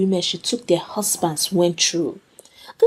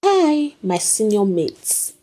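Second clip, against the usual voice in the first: confident; 8 semitones higher, slower than usual. A narrator's reading. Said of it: "her voice oozing with confidence".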